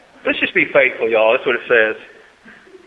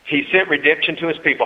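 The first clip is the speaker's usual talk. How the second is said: ordinary talk, nothing marked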